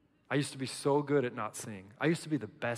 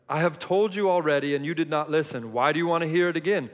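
exasperated healed man; 4 semitones higher, 7 dB louder than usual. Acted. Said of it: "He's like".

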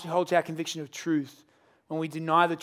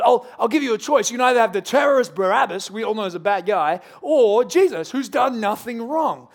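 louder, higher mock-generous pitch; showy offer; 4 semitones higher, 6 dB louder than usual. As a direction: play the slick crowd-pleaser. Pilate offering crowd a choice.

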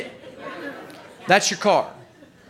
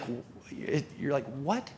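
hushed, stammering disbelief; stunned; 3 semitones lower, 12 dB quieter than usual. Acted. Customer told the debt is paid off, stunned and hushed. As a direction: start to speak, falter, barely get it out.

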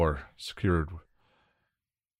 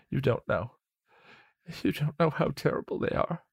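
much higher, softer, frightened voice; a scared hush of warning; frightened William, hushed; 8 semitones higher, 4 dB quieter than usual. Acted. William warns Willow about the captors in a frightened hush.

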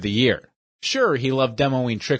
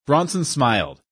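ordinary delivery

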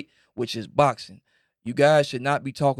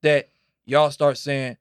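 ordinary talk, nothing marked

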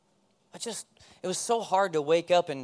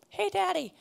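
put-on higher child's voice teasing dad; kid voice; 10 semitones higher, 6 dB louder than usual. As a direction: chirp it like a little kid reminding Daddy. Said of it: "and they'll say".

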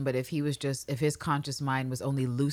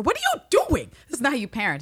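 scolding; 9 semitones higher, 3 dB louder, faster than usual. Retold or imitated. Angela scolding Cal.

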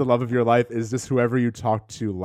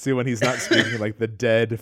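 normal talking voice

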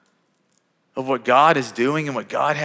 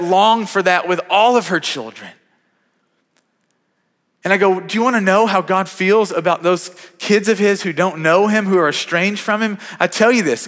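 higher, louder, rising fervor; preaching at full force; 7 semitones higher, 4 dB louder than usual. Real, not acted. Pastor urging the congregation about God's heart, preaching hard. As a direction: drive the point home to the room.

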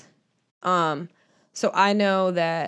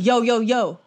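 louder, higher hype-up call; mock battle rapper; 5 semitones higher, 6 dB louder, slower than usual. Reader jokes after voice is criticised, mock rap intro. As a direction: open like a battle rap, in jest. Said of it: "About the battle rap?".

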